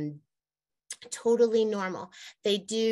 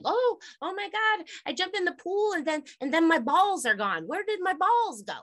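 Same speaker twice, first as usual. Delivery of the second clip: put-on much higher, alarmed boy voice; panicked kid; 9 semitones higher than usual. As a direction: act out a kid who thinks his balls vanished. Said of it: "They're always like".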